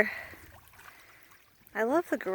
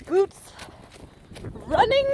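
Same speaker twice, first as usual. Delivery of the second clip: ordinary talk, nothing marked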